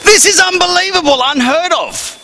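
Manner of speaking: very high, incredulous holler; mock amazement. Real, not acted. Comic calling out laughing doctors to audience, high mock amazement. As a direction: climb high in comic disbelief.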